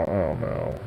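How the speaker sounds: usual speaking voice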